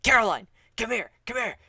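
urgent hushed yell, fast and high; whisper yelling. Retold or imitated. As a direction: call her over without being heard. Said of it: "this whisper yell".

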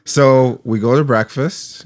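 ordinary talk

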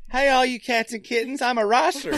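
high mock-accent greeting; Dwight impression. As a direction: pitch it up and say racer as "Rosser". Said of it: "what is that accent".

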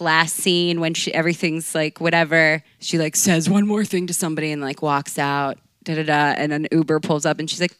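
usual speaking voice

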